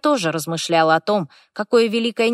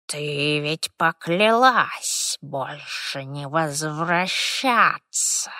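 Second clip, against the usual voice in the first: creaky spirit voice; 2 semitones lower, much slower than usual. Acted. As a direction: creak it out like an old house spirit. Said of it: "знакомый скрипучий голосок". (скрипучий голосок духа)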